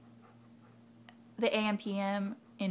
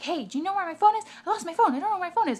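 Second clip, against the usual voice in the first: higher, louder, quicker pleading; imitating tweaker lady; 10 semitones higher, 7 dB louder, much faster than usual. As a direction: pester her about the lost phone.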